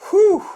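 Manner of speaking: high, loud "whew" of relief; astonished high exhale; relieved whoop. Real, not acted. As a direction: blow out a high whoop after the tension. Relief after the shock win.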